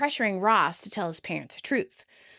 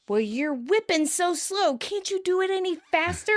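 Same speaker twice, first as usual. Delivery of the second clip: Joey; 8 semitones higher than usual. Acted. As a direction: complain impatiently, climbing higher. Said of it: "And Joey scolds".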